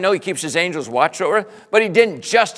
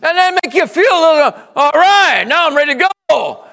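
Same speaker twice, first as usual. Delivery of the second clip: loud, higher, fast fervent preaching; preaching at full force; 8 semitones higher, 8 dB louder than usual. Real, not acted. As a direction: preach it with rising fire.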